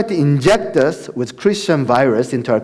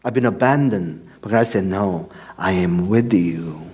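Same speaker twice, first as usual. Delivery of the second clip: quiet, lower voice as he recalls God's answer; hushed, moved; 10 semitones lower, 5 dB quieter than usual. Real, not acted. Prisoner alone in his cell hearing God, hushed.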